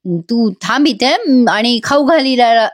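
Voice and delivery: mildly annoyed, slowed voiced order; half-acted grumpy command, drawn out; irritated Gautami. Gautami snaps at Suman to feed Ira, mildly annoyed. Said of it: "ही गौतमी चिडते आणि म्हणते".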